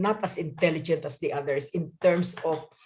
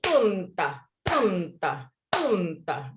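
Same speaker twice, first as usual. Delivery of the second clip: tumta chanted strong then weak; trochaic beat; much slower than usual. Shown as a demonstration. Teacher demonstrating the reversed trochaic beat, chanting tumta. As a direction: stamp the TUM, drop the ta. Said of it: "yung baliktad niyan".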